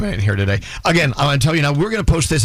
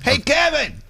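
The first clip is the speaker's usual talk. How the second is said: calling out; 13 semitones higher, much slower than usual. Real, not acted. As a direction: shout for him to come back. Calling guest from outside room.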